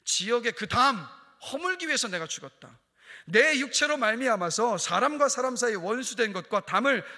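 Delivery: acted divine reproach; the Lord's rebuke. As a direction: thunder it as the Lord confronting believers. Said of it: "주님이 이렇게 말씀하실 거라는".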